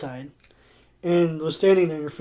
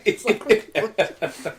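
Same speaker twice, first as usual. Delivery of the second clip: ordinary talk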